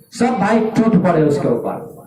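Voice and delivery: usual speaking voice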